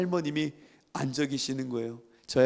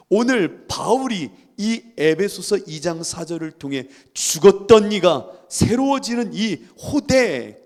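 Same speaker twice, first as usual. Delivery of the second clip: emphatic preaching; 3 semitones higher, 8 dB louder than usual. Acted. Preacher announcing Paul's great reversal, emphatic proclamation. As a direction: hammer out each key word to the congregation.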